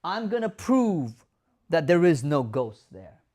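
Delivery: mock insistent caregiver proving there's no ghost; the wrong way, lecturing and loud; know-it-all. Shown as a demonstration. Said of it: "A lot of people say".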